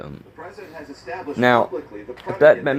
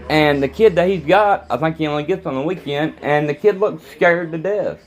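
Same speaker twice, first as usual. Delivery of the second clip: higher, heated venting; worked-up rant; 8 semitones higher than usual. Real, not acted. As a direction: let the anger push the voice up. Ranting, worked up after getting mad.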